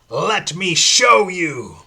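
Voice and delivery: the demon showing the priest his chains; menacing threat. Acted. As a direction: promise pain slowly. Pinhead attacks the priest.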